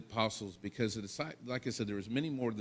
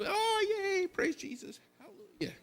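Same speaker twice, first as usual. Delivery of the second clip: much higher squeal of joy, fading out; mock cheering. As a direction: play the women overjoyed, hammed-up. Imagined women cheering at tomb.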